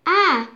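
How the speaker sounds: short startled yell; scream. Acted. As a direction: cry out in fright. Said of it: "he screamed".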